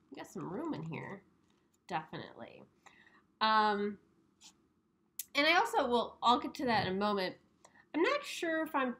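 muffled behind paper mask; speaking into loose mask; through a face mask. Real, not acted. Seen 1: Paper face mask covers mouth and nose throughout; reference frames unmasked.

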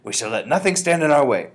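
loud, rousing declaration; crying out. A narrator's reading. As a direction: declare it like a battle cry. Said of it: "she cried, waving her stick again".

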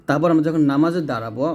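ordinary talk

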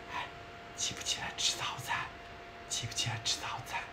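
whispered wake-up question. Retold or imitated. Husband whispers to his sleeping wife about breakfast. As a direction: whisper gently so as not to wake her fully. Quoting husband waking her softly.

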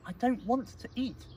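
high, loud kitten protest; pleading explanation. Acted. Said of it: "he tried to explain".

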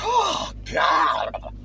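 loud, exaggerated cry of delight; mock-overcome shout; hammed-up rapture. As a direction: burst out over incredible food. Raving about the chocolate bars.

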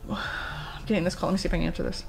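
soft, breathy half-whisper with a sigh; hushed aside. Retold or imitated. Streamer telling viewers she must take a call, hushed. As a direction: drop to a hush, mostly air.

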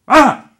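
short, high, loud staccato scream; sharp short scream. Shown as a demonstration. Dad showing the kid how to scream, short sharp scream. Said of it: "Short, staccato. Sharp".

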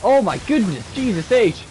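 high, loud alarmed cry; startled exclamation. Real, not acted. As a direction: yelp it out in shock. Sudden hard fight, alarmed.

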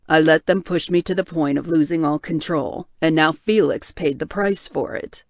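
low, heavy voice of a guilt-ridden man; tortured bear shifter. Acted. Hale blames himself.

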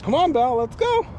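high call rising to a squeak; coaxing the dog. Real, not acted. Owner urges dog onward mid-walk, high coaxing. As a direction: lift it high to get the dog moving.

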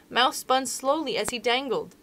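ordinary delivery

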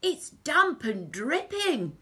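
high, loud complaining villain; Hugh the crook. A narrator's reading. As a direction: moan it like a disgusted thief. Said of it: "said Hugh".

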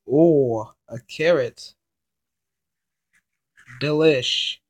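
ordinary delivery

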